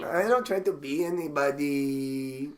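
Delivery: slow echo with a long-held last word; drawn-out mock protest. Echoing the comic's defence, slow mock drawl. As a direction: stretch the protest out, holding the final vowel.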